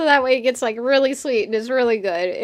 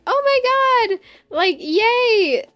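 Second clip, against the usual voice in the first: mock delight; 11 semitones higher, 3 dB louder, slower than usual. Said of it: "I'll be like".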